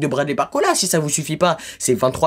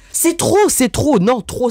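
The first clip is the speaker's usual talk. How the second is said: much higher and louder, fed up; exasperated outburst; 8 semitones higher, 3 dB louder, slower than usual. Real, not acted. Angry vlogger snapping at France Football, fed-up shout. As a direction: explode with "that's enough". Boiling over in a rant.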